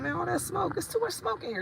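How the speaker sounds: high playful demo of smoke; her own way, mocked. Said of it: "But I say smoke".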